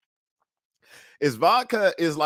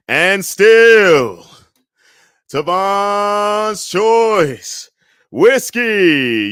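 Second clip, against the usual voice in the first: booming drawn-out announcer call, much higher and louder; ring announcer; 8 semitones higher, 9 dB louder, much slower than usual. Pretend. Host crowning whiskey champion, booming announcer call. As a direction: stretch every word like a title fight.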